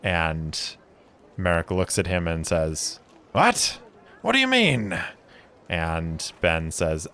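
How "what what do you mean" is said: high puzzled brewer voice; be baffled, pitched way up; confused surprise. Acted. Brewer baffled that his friend changed his name, high surprise.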